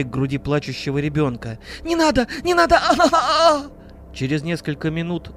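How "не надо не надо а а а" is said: shrieking desperate plea; terrified scream. Acted. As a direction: scream for mercy in horror. Woman begging before murder.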